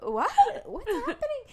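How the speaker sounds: high, alarmed mock panic; panicked what. Girlfriend startled in the shower, high alarmed cry. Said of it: "And I was like".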